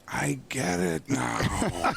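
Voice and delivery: milk the slow light-bulb moment; Madden's realization. Retold or imitated.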